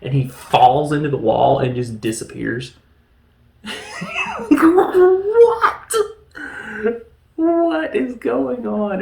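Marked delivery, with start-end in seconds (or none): 3.6-9.0 high burst of laughing disbelief; real laughter. Real, not acted. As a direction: crack up and squeal what. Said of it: "laughing at the silly scene".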